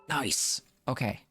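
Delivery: normal talking voice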